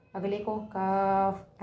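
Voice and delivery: کاف said light and simple, not heavy, slowly; light kaf. Shown as a demonstration. Teacher contrasting light کاف with heavy قاف, slow. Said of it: "موٹی آواز نہیں نکالنی".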